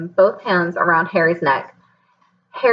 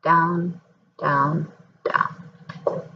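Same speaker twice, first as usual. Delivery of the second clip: each down softer and lower until a whisper; fading away; 3 semitones lower, 7 dB quieter, much slower than usual. A narrator's reading. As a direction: let the voice sink into darkness. Falling into blackness, fading.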